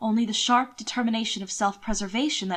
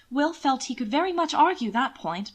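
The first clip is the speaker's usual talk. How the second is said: ordinary delivery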